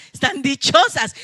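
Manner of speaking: words through a laugh; amused at the happy sisters, laughing as she speaks. Real, not acted. Se ríe de las hermanas. (riéndose de las hermanas contentas)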